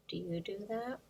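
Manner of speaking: usual speaking voice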